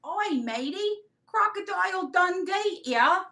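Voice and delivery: high-pitched, badly faked Australian accent; bad Aussie accent. Seller jokes as Crocodile Dundee, bad Aussie accent. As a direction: put on a hammy Australian accent. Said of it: "I cannot do an Aussie accent".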